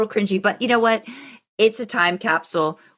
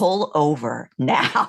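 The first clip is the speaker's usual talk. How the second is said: low, slow clipped order, half-joking; mock-stern command from the back seat; stern passenger; 4 semitones lower, much slower than usual. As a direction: bark it like a furious backseat driver, but lightly.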